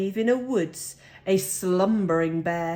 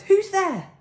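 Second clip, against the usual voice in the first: high, louder startled cry; mouse cries out; 12 semitones higher, 6 dB louder than usual. A narrator's reading. Mouse calling to glowing eyes in the den, high startled cry. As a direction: call out into the dark, alarmed. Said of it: "Mouse cries".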